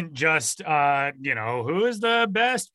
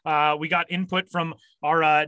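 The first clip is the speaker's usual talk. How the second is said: ordinary talk, nothing marked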